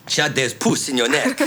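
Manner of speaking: hammed-up Hungarian-accented doctor; Doctor Sarah's accent. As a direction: insist in a put-on Budapest accent. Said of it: "Doctor Sarah comes out and she says".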